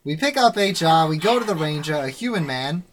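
put-on old-time serial announcer recap; Legend of Korra announcer. Game master recaps the adventure to players, announcer voice. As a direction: punch out each phrase like an episode recap. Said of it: "I'll be the announcer from The Legend of Korra".

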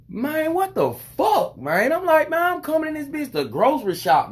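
high, strained disbelief; exasperated outburst. Retold or imitated. Retelling his own fed-up outburst at the lady. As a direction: blurt it out fed up.

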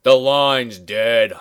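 slow, flat-out dry delivery; deadpan complaint. Retold or imitated. Husband to his mother, slow dry sarcasm. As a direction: drag it out, fed up with mom. Husband quoted after dead phone line.

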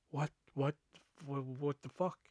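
quiet, halting disbelief; stunned anger. Retold or imitated. Worker seeing the kiosk wrecked, stunned quiet anger. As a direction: stammer it softly, too mad for words. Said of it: "I was just so fucking mad".

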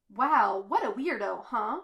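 cheerful, lively remark; cheery. A narrator's reading. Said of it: "she says cheerily".

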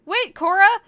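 loud high call after her. Acted. Sesshomaru calling after Korra as she storms out, loud. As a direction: yell after someone leaving.

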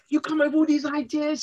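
lightly voiced wife cheering her husband on; wife's affectionate voice. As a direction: lift the voice, play the wife praising him. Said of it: "she goes".

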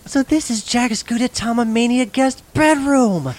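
high, slow amazed exclaim. Acted. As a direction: gasp in delighted disbelief. Amazed entering the themed room.

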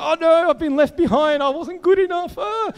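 high-pitched panicked wail, climbing steadily; mock crying wail. Speaker acts out their young self thinking everyone got raptured, mock tearful panic. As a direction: wail it like a hammed-up distraught child. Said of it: "I was screaming, I was crying".

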